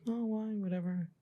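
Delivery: high, soft brush-off; dismissive teacher. Retold or imitated. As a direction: imitate a teacher who can't be bothered.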